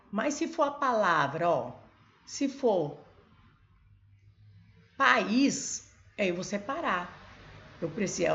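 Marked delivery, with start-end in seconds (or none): 5.0-5.9 país said high and loud, stress on the final syllable; stressed example. Shown as a demonstration. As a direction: hit país on the second syllable. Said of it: "olha assim".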